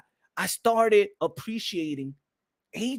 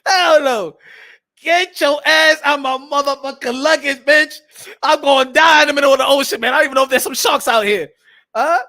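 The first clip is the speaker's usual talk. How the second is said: loud, emphatic comic yelling; hammed-up outrage, belting it out; mock-furious refusal; 3 semitones higher, 7 dB louder than usual. Man guarding luggage among sharks, loud mock fury. Acted refusal on the sinking ship.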